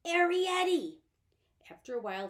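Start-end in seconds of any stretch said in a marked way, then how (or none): 0.1-1.0 high, loud call into the hole; louder second call. Acted. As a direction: call her name down into the dark, louder. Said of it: "he called softly".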